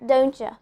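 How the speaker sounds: "Don't you" as doncha; fuse "Don't you" into don-cha; "Don't you" with T and Y merged into CH; linked words. Shown as a demonstration. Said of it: "it's becoming".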